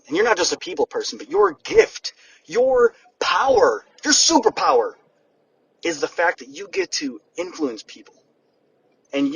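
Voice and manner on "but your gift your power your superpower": each phrase climbing higher, superpower loudest; building emphasis. Real, not acted. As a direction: stretch out your power slowly, then hit superpower high. Building list to the key word.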